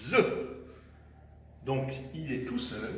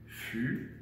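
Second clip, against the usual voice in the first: « Fusée » softly in two separate beats; split into syllables; 6 dB quieter than usual. Shown as a demonstration. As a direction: softly dictate « Fusée », pausing after fu. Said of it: "je répète, deux syllabes". (« Fusée » découpé doucement en deux syllabes)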